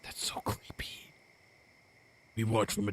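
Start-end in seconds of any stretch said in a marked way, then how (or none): 0.0-1.1 whispering. Real, not acted. Whispered aside to co-player.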